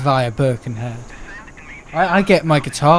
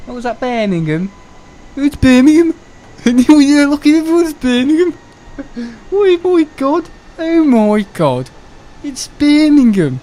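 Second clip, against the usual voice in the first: put-on high, drawn-out Brummie accent; hammed-up Birmingham accent, pitched well up; exaggerated Brummie excitement; mock Birmingham accent; 10 semitones higher, 3 dB louder than usual. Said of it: "I don't like that accent".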